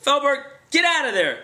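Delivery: loud, high commanding yell; shouting order. Retold or imitated. Bounty hunter ordering fugitive out of trunk, loud shout.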